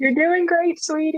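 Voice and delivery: high, sugary mock comfort; mock sweet high voice. Friend mocking Matt's comforting line, cooing high. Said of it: "mocking Matt's comfort".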